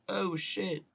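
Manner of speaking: high-pitched startled yelp in imitation; re-enacted jolt of panic; acted alarm. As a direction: act out a sudden slip scare. Acts out slipping while cutting wood.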